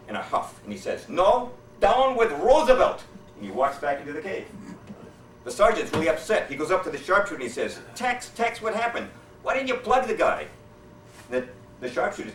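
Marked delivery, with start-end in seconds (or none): 1.1-3.0 slow, high, loud retort; shout back. Acted. Japanese soldier shouts back at the sharpshooter, high, loud and slow. 7.9-10.5 higher, exasperated questioning; upset sergeant. Acted. Upset sergeant confronts the sharpshooter, high and exasperated.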